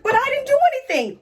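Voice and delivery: high, whiny mock protest of innocence; Brienne. Accused knight pleading with her captors, high mock protest. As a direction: squeal the denial like someone caught red-handed.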